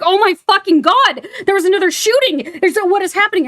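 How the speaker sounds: high, loud mock panic; panicked freakout. Sharon hearing of a shooting, high panicked outburst. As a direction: shriek it like a mom hearing terrible news. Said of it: "She's, like, freaking out".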